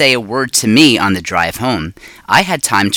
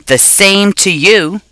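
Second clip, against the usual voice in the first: high, slow rude hamster squeak; 9 semitones higher, 3 dB louder, slower than usual. A narrator's reading. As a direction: squeak it back rudely, like a hamster. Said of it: "I squeaked back rudely".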